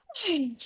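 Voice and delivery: high, falling sigh; audible sigh, sliding down; demonstrated sigh. Shown as a demonstration. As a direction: let out a long releasing sigh. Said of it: "I feel like I wanna go".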